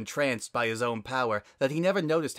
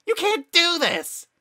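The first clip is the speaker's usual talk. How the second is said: much higher, louder screaming protest; frantic screech; 8 dB louder than usual. Acted. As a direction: lose it on the repeat. Flowey protests, escalating.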